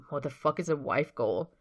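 a mild irritated snap of a question; irritably. A narrator's reading. Patrick asks his teammate, mildly irritated. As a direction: let a touch of annoyance through. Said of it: "asks Patrick irritably".

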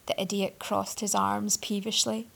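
ordinary delivery, nothing marked

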